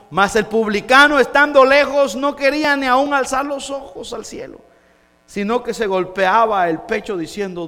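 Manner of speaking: loud, high, sustained proclamation; forceful scripture preaching. Acted. Preacher reciting the parable to the congregation, full force. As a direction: declaim the verse to the whole church.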